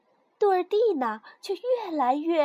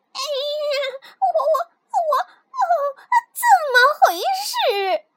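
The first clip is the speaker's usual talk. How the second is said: high, loud, wailing panic; panicked; 13 semitones higher, 8 dB louder than usual. Acted. Girl finding a frog leapt from her mouth, panicked. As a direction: shriek in horrified bewilderment.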